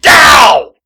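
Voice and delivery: sharp yelp of pain. Acted. Man jolted by pain yelps out loud. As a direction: yelp as if hurt.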